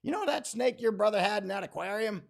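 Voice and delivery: lightly voiced old woman; Viola confessing. Viola telling the speaker she killed the snake, lightly voiced. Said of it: "She's like".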